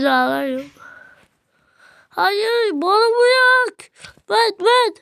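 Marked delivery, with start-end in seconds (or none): 2.1-4.9 high, drawn-out pleading; real whining plea, slow and loud; desperate whine. Real, not acted. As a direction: wail it like the game depends on it. Begging during game.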